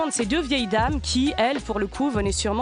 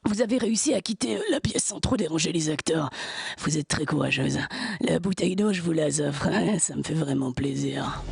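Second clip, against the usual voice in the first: suave voice; 6 semitones lower than usual. Retold or imitated. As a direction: purr it like a sleazy charmer. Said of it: "cette douce voix suave".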